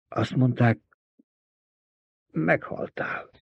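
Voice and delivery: rasping, half-whispered disbelief; hoarse, choked whisper; hoarse groan. A narrator's reading. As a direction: croak it out, shaken. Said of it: "nyögte rekedten Görni".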